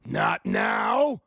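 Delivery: deep, loud tree bellowing no; defiant boom from the old tree; loud protest. A narrator's reading. Tree protests against being cut.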